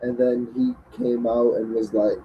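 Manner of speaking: ordinary talk, nothing marked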